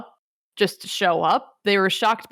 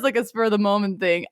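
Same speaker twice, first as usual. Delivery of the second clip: ordinary talk, nothing marked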